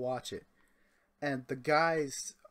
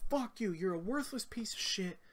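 much higher mock drunk yelling; drunk coworker insulting; 7 semitones higher than usual. Drunk coworker cursing the driver, high mock yell. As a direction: mimic a drunk guy cursing you out, pitched way up. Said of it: "And he's like".

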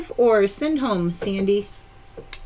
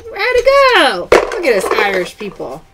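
excited cheer; 7 dB louder than usual. Real, not acted. Host cheers on her live viewers, excited. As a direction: cheer them on.

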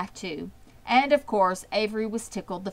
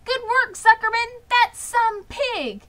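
much higher, loud cheering yell; crowd yelling; 6 dB louder than usual. A narrator's reading. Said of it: "yelled the crowd".

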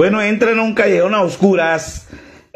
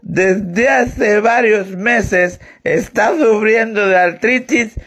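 put-on slow, slurred drunk reading; drunkard; 6 dB louder, much slower than usual. Drunk reads the newspaper to the priest, slurring slowly. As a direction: labour through each word like a drunk. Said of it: "Dice el borracho".

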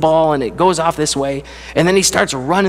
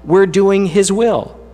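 preaching with mounting fervour, pitched higher; rising preacher voice; 5 semitones higher than usual. Acted. Preacher telling his congregation what God gives them, fervent and building. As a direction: lift the congregation with each line, building.

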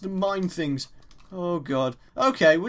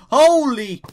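loud, raised cry at a sudden threat; startled shout; 3 semitones higher, 6 dB louder, slower than usual. Real, not acted. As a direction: yell out in surprise, then threaten the attacker. Startled by an attacker in game.

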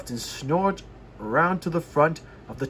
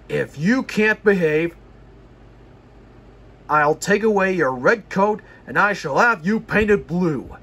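stern boss warning the engine; firm and louder, a real telling-off; severe; 3 semitones higher, 5 dB louder than usual. Acted. The controller scolding James, severe and loud. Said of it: "spoke severely to James".

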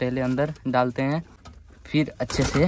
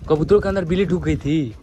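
raised voice; 4 semitones higher, 7 dB louder than usual. Acted. Staged quarrel over pigeons.

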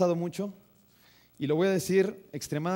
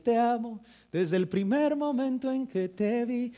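slow, higher, tender lover's vow; kneeling romantic declaration; 5 semitones higher, slower than usual. Shown as a demonstration. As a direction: declare love on one knee, slowly and higher. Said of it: "te arrodillas y le dices".

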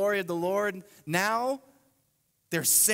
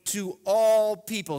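loud, slow stress on all; emphasis on all; 3 semitones higher, 7 dB louder, slower than usual. Real, not acted. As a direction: hit all people hard. Stressing third truth.

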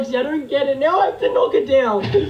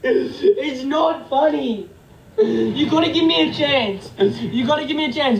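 slow, half-crying plea; whiny pleading; slower than usual. Real, not acted. Streamer begging viewers for one more chance, tearful whining. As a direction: drag it out, begging for mercy. Pleading after unwanted gift.

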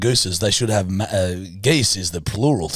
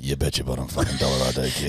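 mock tough guy; 11 semitones lower, 6 dB quieter than usual. Gruff defiant reply.

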